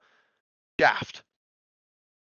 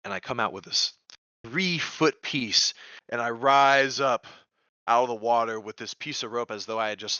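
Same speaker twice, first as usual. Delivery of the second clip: ordinary talk, nothing marked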